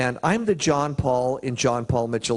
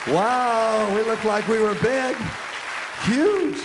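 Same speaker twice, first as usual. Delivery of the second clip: high, wowed exclamation; hammed-up awe, pitched way up; mock amazement; 8 semitones higher, slower than usual. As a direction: marvel in a raised voice, topping out on "huge". Marvels at sounding like big company.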